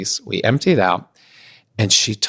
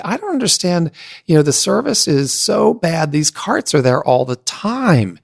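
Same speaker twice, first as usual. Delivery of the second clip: raised-pitch baffled complaint; indignant quote; 6 semitones higher than usual. Retold or imitated. Young man complaining to the office staff, baffled. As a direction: complain high and puzzled. Retold complaint about carts.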